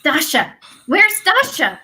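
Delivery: high, loud calls through the house; calling out. Acted. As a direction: shout for the maids in alarm. Widow calling servants.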